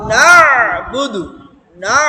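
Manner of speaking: slow না'বুদু, leaning loudly on the throaty first syllable; pressed throat sound. Shown as a demonstration. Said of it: "চাপ দিও, হ্যাঁ?". (না'বুদু-র না-তে গলায় চাপ)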